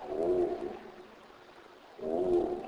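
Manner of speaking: "oo" as a held W glide; letter sound demo. Shown as a demonstration. Teacher shows how W is pronounced, a held "oo". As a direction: sustain "oo" like the start of "wow". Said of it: "pronounced like the W in the word wow".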